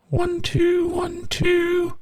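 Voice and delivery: high processed plugin voice; pitch-shifted voice. Shown as a demonstration. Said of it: "we can put in the, the key we want".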